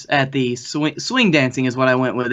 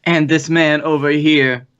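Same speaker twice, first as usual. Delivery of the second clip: ordinary talk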